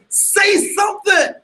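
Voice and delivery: slow, lifted mock order; inner voice commanding. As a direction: call it out like a voice in your head ordering you. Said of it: "But the voice says".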